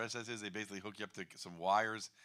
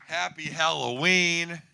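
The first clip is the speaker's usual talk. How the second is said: drawn-out greeting; 5 semitones higher, 12 dB louder, much slower than usual. Real, not acted. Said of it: "greeting the crowd".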